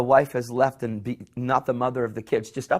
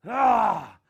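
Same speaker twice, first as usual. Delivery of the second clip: mock anguished cry; 8 semitones higher, 4 dB louder than usual. Screaming at God.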